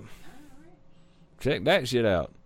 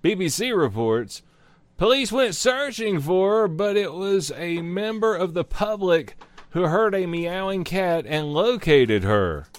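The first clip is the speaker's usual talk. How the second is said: news read; 4 semitones higher than usual. Acted. Reading news article aloud.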